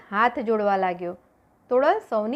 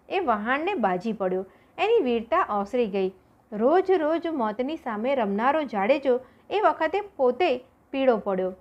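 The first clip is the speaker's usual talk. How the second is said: plain reading voice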